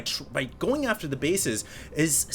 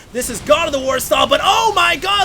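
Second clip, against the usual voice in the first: much higher, loud screaming call; excited shout; 12 semitones higher, 7 dB louder than usual. Real, not acted. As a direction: scream it as the army dies. Caster erupts at Mutalisk loss.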